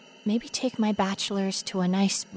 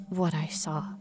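ordinary delivery

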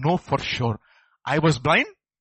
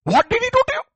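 much higher, louder demand; incredulous demand; 7 dB louder, faster than usual. Retold or imitated. Pharisees demanding of the healed man, high and loud. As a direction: fling the question high. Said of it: "They cannot believe".

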